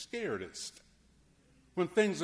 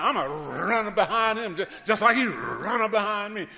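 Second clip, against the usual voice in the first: road rage; 4 semitones higher, 9 dB louder than usual. Retold or imitated. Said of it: "you decided".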